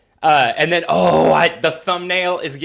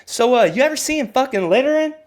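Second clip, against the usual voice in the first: lightly acted interviewer, pitched up; higher-pitched pretend interviewer and interviewee; mock interview; 5 semitones higher than usual. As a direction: play both sides of an eager interview. Acts out investigator interviewing an acquaintance.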